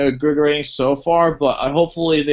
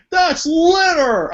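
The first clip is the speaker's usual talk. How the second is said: high, very slow dopey outrage; dumb cop voice; 13 semitones higher, much slower than usual. Retold or imitated. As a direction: stretch it out like a dim cop. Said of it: "He's like".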